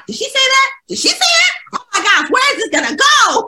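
squealing did-she-just-say-that shock; shocked disbelief. Retold or imitated. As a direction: blurt it out like you can't believe your ears. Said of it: "And we're like".

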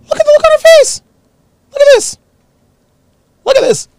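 shouting it up high and loud; high loud exclamation. Real, not acted. Vlogger yelling at viewers over her face, high and loud. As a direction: yell high in disbelief.

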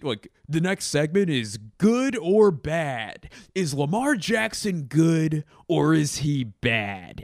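slow, loud, dumbed-down sports host voice; mocking dumb pundit. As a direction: parody a shallow TV debate question, drawn out.

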